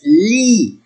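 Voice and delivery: "Lis" drawn out as "lee"; silent final S. Shown as a demonstration. Teacher shows the silent letter, "Lis" without S. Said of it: "I don't say it letter S".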